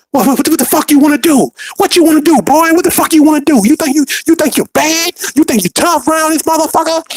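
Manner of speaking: high-pitched hectoring mock scream; screaming drill instructors. Drill instructors circling a recruit and screaming in his ear, high mock scream. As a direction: yell rapid taunts in a strained, high voice. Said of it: "screaming in my ear".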